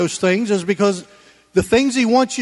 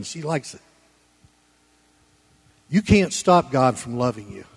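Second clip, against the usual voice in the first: normal talking voice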